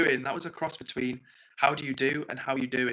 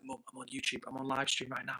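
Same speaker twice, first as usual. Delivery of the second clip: hushed to mum; 9 dB quieter than usual. Retold or imitated. Hushing mum while live streaming.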